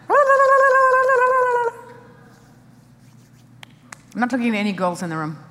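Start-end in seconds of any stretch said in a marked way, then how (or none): 0.1-1.7 very high, loud la-la chatter; hammed-up babbling of a fretful mouth; mock frantic la-la babble. Preacher mocking a running mouth, very high mock babbling.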